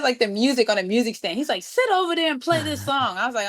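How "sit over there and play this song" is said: bossy bandleader. Retold or imitated. Man orders her to play.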